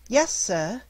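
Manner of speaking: lightly voiced servant girl, polite; maid's voice. A narrator's reading. Servant girl answers Scrooge.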